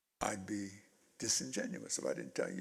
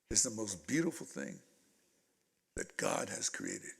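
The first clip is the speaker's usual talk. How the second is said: tender mother; 7 semitones lower than usual. Retold or imitated. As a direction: soothe a hurt child, word by word. Mother's comforting words, acted in story.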